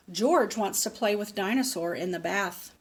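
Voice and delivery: plain reading voice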